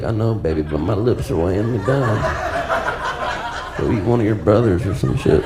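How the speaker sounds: low, weary mock-mom voice; weighed-down mother. Mother answering her hungry children, low and burdened. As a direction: drop low, as if the lips are too heavy. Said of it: "She's like".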